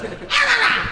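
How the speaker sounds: high whiny puppy ah ah; puppy noises. Retold or imitated. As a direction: yip it like a stubborn puppy. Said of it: "he goes".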